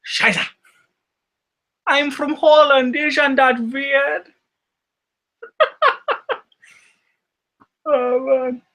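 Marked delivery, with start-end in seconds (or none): 0.0-4.3 high, drawn-out mock Dutch accent; hammed-up Dutch accent, slow and high; Goldmember. Quoting an Austin Powers villain, mock Dutch accent. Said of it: "Why is, why is Madness quoting Goldmember?".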